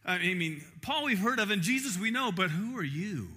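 a hint of a scornful spirit calling them out; demon's challenge. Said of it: "they said".

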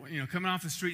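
normal talking voice

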